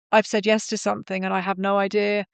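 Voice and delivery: usual speaking voice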